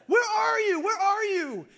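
high, loud searching shout; desperate calling out. Acted. Hanging man calls to God above, desperate high shout. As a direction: call up to someone out of sight.

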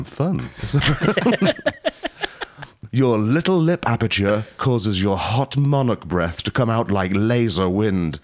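normal talking voice

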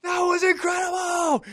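high, slow, shouted joy; thrilled shout. Retold or imitated. Jumper to his partner after landing, shouting high and thrilled. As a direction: yell it with pure post-landing adrenaline. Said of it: "I'm like".

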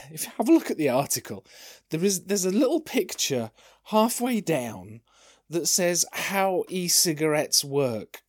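usual speaking voice

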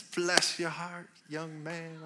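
put-on sweet old lady. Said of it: "She says".

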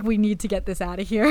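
mock urgent body voice; a hint of a panicked inner voice; body's voice.

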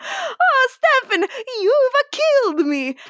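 very high, dying Italian melodrama; squeaky comic Italian, breaking into laughter; mock Italian accent. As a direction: wail it in a hammy Italian accent. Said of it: "I have to do the Italian accent".

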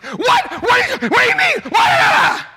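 very high, loud frantic mock shouting; overreacting panic. Dad hearing of son's seizure, hammed-up panicked overreaction. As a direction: shriek each question in comic alarm. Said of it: "I overreact".

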